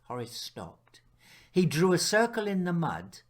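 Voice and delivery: plain reading voice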